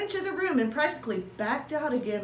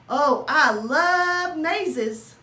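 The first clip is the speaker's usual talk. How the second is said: delighted; 4 semitones higher, 7 dB louder, much slower than usual. Acted. Hamster delighted by maze.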